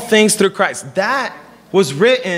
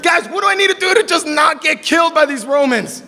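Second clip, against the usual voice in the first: frantic shouted plea, higher; panicked shout; 6 semitones higher than usual. Retold or imitated. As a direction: yell for help, scared stiff. Jailer panics, doors open.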